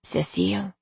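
hushed, secretive naming; whispered word. A narrator's reading. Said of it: "he whispered a single word".

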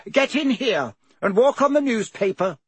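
high-pitched aunt calling him in. A narrator's reading. Aunt calling Harry indoors.

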